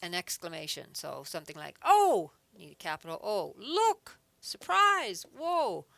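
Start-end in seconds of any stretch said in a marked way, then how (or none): 1.8-2.3 a sudden high, loud "Oh" like a real exclamation. Shown as a demonstration. Teacher demonstrating an interjection to the class, excited cry. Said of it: "capitalize an interjection, an exclamation". 3.6-5.9 high, loud surprised exclamations, word by word; excited interjections. Shown as a demonstration. Teacher acting out interjections for students, excited and surprised. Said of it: "capitalize an interjection".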